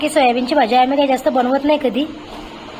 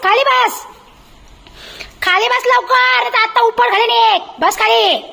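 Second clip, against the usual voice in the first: high-pitched mock telling-off of the pet; mother playfully threatening her dog; mock scolding; 6 semitones higher than usual. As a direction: scold the dog like a naughty child, half in jest.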